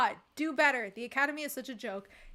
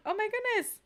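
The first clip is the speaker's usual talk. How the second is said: usual speaking voice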